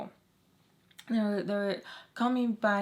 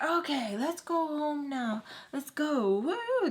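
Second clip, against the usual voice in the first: high, drawn-out gleeful call rising to a whoop; excited whooping; 7 semitones higher, slower than usual. Retold or imitated. Imitating the laughing guy who thinks it's hilarious, excited hollering. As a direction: imitate him hollering, pitch way up. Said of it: "Just screaming".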